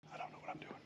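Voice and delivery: barely audible whispered confession; whispered aside. Real, not acted. Whispered confession after mock boast.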